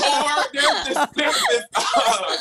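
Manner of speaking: words broken up by laughter; laughing. Real, not acted. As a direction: laugh through the start of the story. Laughing while starting story.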